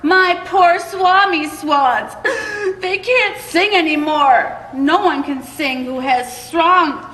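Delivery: a distressed little creature crying out at the Onceler; croaking Lorax cry. Acted. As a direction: cry out high and croaky, half sobbing. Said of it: "he cried with a crofulous croak".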